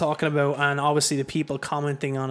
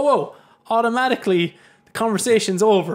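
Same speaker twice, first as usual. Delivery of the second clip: much higher, louder whoa; exclaiming; 6 semitones higher, 6 dB louder than usual. Real, not acted. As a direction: throw up your hands in alarm. Exclaiming at online hostility.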